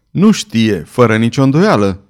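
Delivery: ordinary delivery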